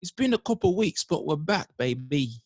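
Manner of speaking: a light, high put-on Cockney accent; mock Cockney accent. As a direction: greet them like a cheeky Cockney host. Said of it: "was that Cockney?".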